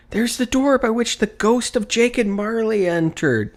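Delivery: high, bright wonder; delighted recognition. A narrator's reading. Scrooge pointing out where the spirits were, delighted. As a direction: tick off each spot in delight.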